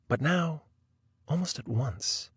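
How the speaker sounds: ordinary delivery, nothing marked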